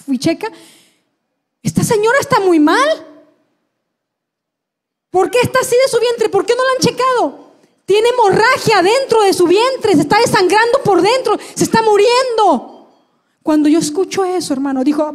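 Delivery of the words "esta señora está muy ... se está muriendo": high, urgent alarm of a doctor; médico alarmado. Retold or imitated. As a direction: raise the alarm over a dying patient. Doctor alarmed at her bleeding. (alarma urgente, con voz aguda)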